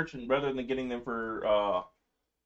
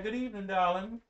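a hint of Southern charm; Southern belle; 7 semitones higher than usual. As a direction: greet like a Southern lady. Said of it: "And she's Southern".

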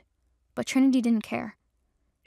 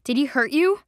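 sharp, louder demand in anger; angry; 4 semitones higher, 7 dB louder than usual. Acted. Juniper angrily asks her crying friend. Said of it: "Juniper's face tightened in anger".

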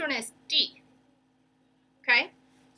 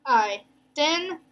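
"Identify" higher, with pauses between syllables; four separate syllables; 4 semitones higher, 3 dB louder than usual. Shown as a demonstration. Teacher recapping the last word, "Identify" syllable by syllable. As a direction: space out "Identify" in four beats. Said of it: "broke up all these words between their syllables".

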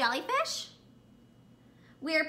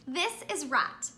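usual speaking voice

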